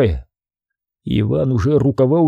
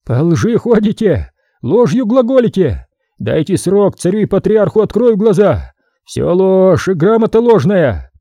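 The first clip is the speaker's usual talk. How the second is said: shouting; 6 semitones higher than usual. A narrator's reading. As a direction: cry out against the lie. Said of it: "закричал".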